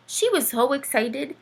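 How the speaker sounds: plain reading voice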